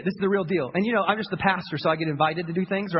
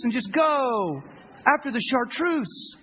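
ordinary talk, nothing marked

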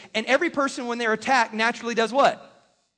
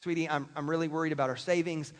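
gentle I statement; 6 semitones lower, 5 dB quieter than usual. Shown as a demonstration. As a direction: reassure your wife about the savings. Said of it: "a little bit more like this".

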